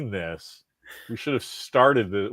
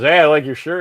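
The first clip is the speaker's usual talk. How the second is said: put-on old guy stranger; 7 semitones higher, 10 dB louder than usual. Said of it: "And he goes".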